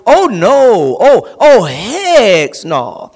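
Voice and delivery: loud, drawn-out outright refusal; emphatic refusal. Acted. Preacher acting out telling somebody off, emphatic refusal. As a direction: refuse it forcefully, leaning long on "heck". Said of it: "when you really wanna tell somebody".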